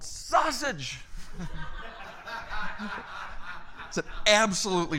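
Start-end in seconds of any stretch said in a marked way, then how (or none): 0.0-1.0 higher yelled answer; mild mock yell. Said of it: "And he yelled". 1.0-3.8 real laugh at the punchline; real chuckle. Real, not acted. Laughing at own story.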